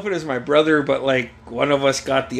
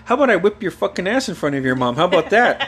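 raised, punchy mock threat; imitated threatening dad; 6 semitones higher, 3 dB louder than usual. Dad threatening a bargaining kid in front of mom, mock angry. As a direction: act out dad losing patience, pitched up.

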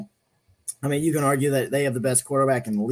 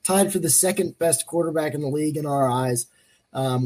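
ordinary delivery

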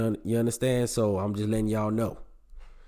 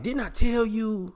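high, slow scolding told you so; mock told you so; 10 semitones higher, slower than usual. Telling his ex off over the car, high slow scold. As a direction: drag it out, pitched up, scolding.

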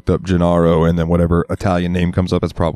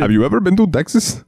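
higher, put-on Indian accent with T's as D's; exaggerated Indian accent; mock Indian accent; 11 semitones higher than usual. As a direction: ask it in a cartoon Indian accent. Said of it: "it makes an Indian accent".

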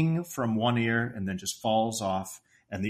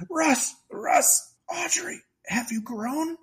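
high, parched croak; scratchy dehydrated voice; slower than usual. Retold or imitated. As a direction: croak it out like someone dying of thirst. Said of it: "He's got the scratchy voice".

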